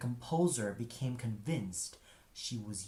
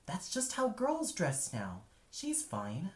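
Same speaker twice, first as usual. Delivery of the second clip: lightly voiced wife, brushing off the worry; dismissive wife; 7 semitones higher than usual. A narrator's reading. Wife brushes off her husband's worries, mildly dismissive. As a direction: wave his concern away with a hint of eye-rolling. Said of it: "His wife would roll her eyes and swat away".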